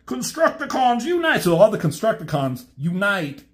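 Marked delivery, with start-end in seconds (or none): 0.0-1.4 very high, gleeful battle call; rally the team like an eager brute; very high laughing robot war cry; Constructicon voice. Retold or imitated. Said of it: "He's like".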